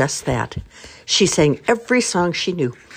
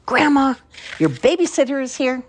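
excited shout; 10 semitones higher than usual. Acted. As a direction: announce it proudly.

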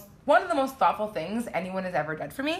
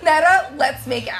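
put-on higher, louder, laughing drunk-mom voice; tipsy mock shout; 6 semitones higher, 9 dB louder than usual.

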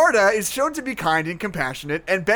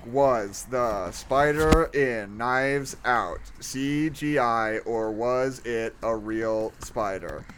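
slow typed query; 6 semitones lower, 4 dB quieter, much slower than usual. Shown as a demonstration. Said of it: "reading search query aloud".

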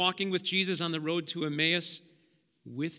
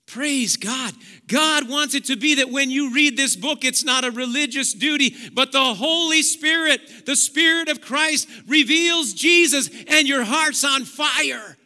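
fiery preaching; 6 semitones higher, 8 dB louder than usual. Acted. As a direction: preach it to the rafters. Preaching climax.

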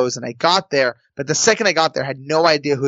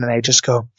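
ordinary delivery

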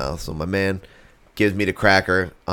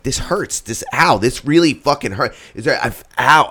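higher, pleading cries of pain; panicked pain; 6 semitones higher, 3 dB louder than usual. Retold or imitated. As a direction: act out sudden stinging pain in a panic. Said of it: "And I was like".

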